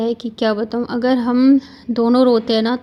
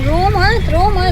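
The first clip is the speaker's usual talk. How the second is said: high and louder, comforting; tender hushing; 8 semitones higher, 4 dB louder, slower than usual. Real, not acted. Mother to sobbing child at farewell, high tender hush.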